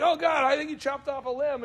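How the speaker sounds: hammed-up wincing complaint; mock pained customer. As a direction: protest weakly, as if hurt. Customer hurt by rough massage.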